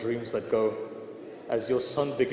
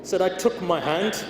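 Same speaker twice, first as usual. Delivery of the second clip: raised, pleading sermon voice; impassioned preaching lament; 3 semitones higher, 3 dB louder than usual. Acted. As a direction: preach the grief out to the hall. Preaching the lament at full force.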